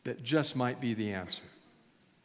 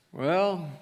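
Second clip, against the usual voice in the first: drawled southern "well"; a friend's southern accent, lightly put on; 2 semitones higher than usual. Friend thinking over the invitation, southern accent. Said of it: "they spoke with a southern accent".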